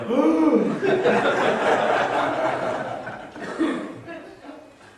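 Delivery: high breathless gasp of shock; mock gasp. Sponsors reacting as pastor offers ride, mock shocked gasp. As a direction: gasp as if scandalized. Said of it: "And the sponsors go".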